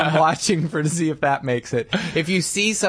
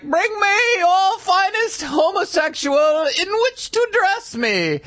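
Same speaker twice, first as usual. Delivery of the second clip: put-on British accent; 13 semitones higher, slower than usual. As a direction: draw it out grandly in a mock British voice, way up high. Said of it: "adopt, like, a British accent".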